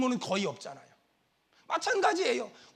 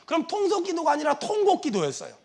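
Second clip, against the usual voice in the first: ordinary talk, nothing marked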